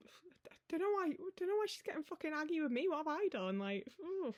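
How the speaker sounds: a tiny high muttering complaint; odd small voice. Acted. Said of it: "I don't know why I'm talking like that".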